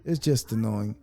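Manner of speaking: a weary sigh, then a low grumble; annoyed sighing mutter. Retold or imitated. Fed up with prank videos, muttering to himself in annoyance. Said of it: "I'm like".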